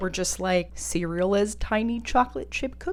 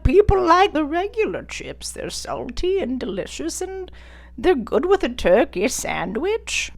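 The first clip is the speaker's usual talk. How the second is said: put-on raised, strained voice of a tired old designer; old man voice; 6 semitones higher than usual. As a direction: play a weary old-timer set in his ways. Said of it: "using my old man voice".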